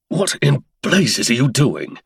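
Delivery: sharp angry demand; lashing out. Acted. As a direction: lash the words at her. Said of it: "he lashed".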